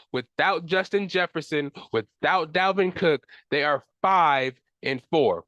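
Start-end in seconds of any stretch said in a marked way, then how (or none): none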